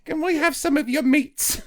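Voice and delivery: high mock-orphan begging, laugh creeping in; Oliver Twist. Hungry newcomers beg for deli meat, in a high mock-orphan voice. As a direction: beg in a squeaky urchin voice. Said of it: "Oliver Twist".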